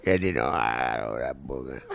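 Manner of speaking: very low Italian-American grumble. Acted. Blue-collar men interacting, deep mumbled Italian-American accent. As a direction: drop deep and slur it, Italian-American style.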